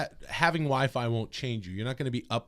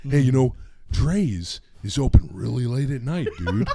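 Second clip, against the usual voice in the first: Hulk Hogan voice; 3 semitones higher, 7 dB louder than usual. Shown as a demonstration.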